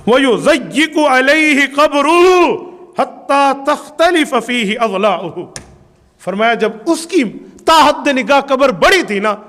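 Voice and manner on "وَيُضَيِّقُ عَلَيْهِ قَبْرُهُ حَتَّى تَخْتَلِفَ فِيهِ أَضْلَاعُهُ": forceful hadith recitation. Acted.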